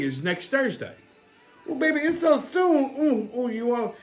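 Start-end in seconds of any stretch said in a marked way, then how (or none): 1.7-3.9 Big Mama. Acted. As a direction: purr it like a flirty auntie.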